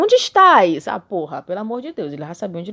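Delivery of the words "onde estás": high, loud call of God; booming God voice. Acted. Reading God's words to Adam, high and loud. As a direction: boom out the question like God. God's question read aloud.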